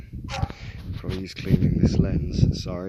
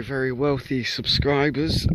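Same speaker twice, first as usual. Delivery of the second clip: normal talking voice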